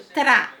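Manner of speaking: tra said alone as the opening syllable; syllable tra isolated. Shown as a demonstration. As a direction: split off tra cleanly. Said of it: "o T e o R lá do comecinho".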